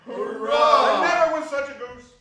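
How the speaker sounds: gleeful cheer at the goose; joyful cheer. Acted. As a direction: shout it with a laugh of glee.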